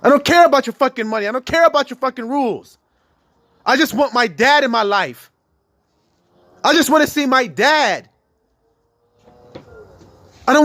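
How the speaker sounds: put-on high, loud pleading cry from the girl's side; child's desperate plea. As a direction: shout the child's longing at the whole system. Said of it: "that's what she's saying".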